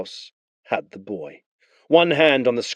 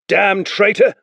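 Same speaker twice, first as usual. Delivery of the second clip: higher, louder spat insult; angry soldier; 11 semitones higher, 6 dB louder, slower than usual. Acted. Blue Cloak curses the fallen man, angry. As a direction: curse him as you strike.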